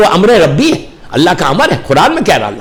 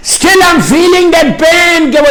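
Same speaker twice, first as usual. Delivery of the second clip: high, drawn-out preacher's cry; emphatic, pained cry; 8 semitones higher, 3 dB louder, much slower than usual. Acted. Preacher repeats dead man's words to listeners, emphatic pained cry. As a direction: raise the pitch and stretch the pain out forcefully.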